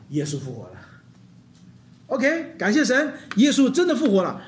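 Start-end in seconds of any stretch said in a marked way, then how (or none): 0.1-0.7 soft, low and hesitant; quiet mock declaration. Preacher shows the congregation's weak answer, soft mumble. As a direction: say it like you barely believe it. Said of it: "你都说，".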